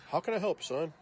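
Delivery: acted-out clerk addressing a kid; retold store clerk. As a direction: punch out the clerk's question. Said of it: "Just like that".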